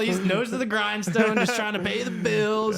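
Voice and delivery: high, put-on voice of a tired working dad; mock hardworking dad voice. Teller voicing Papa Joel, high hardworking dad voice. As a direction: play up the dad grinding away to pay the bills.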